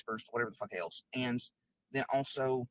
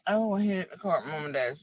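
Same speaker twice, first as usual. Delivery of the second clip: mocking quote; 6 semitones higher, 8 dB louder than usual. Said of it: "Some bitch is like".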